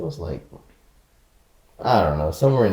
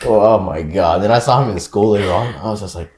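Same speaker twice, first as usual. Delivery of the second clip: ordinary talk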